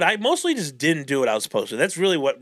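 ordinary delivery

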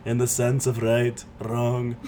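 hammed-up movie character impression; mock-earnest character monologue in a put-on voice; Fat Bastard. Telling friends of an overweight childhood, Fat Bastard impression. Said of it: "I'll do fat bastard".

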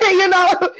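laughing through the words; high giggling quote. Retold or imitated. Laughing through retold reply.

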